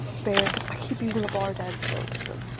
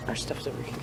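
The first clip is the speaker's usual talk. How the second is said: whispering; 9 semitones lower, 8 dB quieter than usual. Real, not acted. Aside in store.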